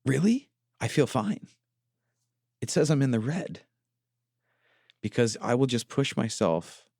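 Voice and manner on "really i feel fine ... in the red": playful self-imitation of surprise; incredulous. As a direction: act shocked at the fitness score. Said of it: "And I just go".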